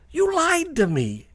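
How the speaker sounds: high, loud, drawn-out cry of betrayal; bitten warrior's accusation. Acted. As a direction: cry it out slowly, acting the dying victim. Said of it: "he's gurgling 'cause".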